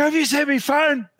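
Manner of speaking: high, frantic retold question; retold panic. Runner asking after a lost phone, panicked. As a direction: re-enact a panicked, high-pitched plea.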